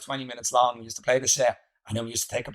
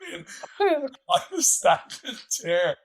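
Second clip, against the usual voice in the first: laughing; 13 semitones higher than usual. Real, not acted. Laughing at the memory.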